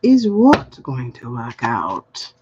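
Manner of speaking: low, slow sassy oracle. Pretend. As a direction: sass it back low and slow as the cards. Card voice answering back.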